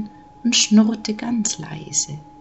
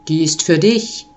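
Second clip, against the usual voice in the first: lightly deepened, kindly voice; friendly Nikolaus; 4 semitones lower than usual. A narrator's reading. Nikolaus rewards a hard-working child, friendly and lowish. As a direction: hand over a gift as a kind big man. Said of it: "sagte der Nikolaus freundlich".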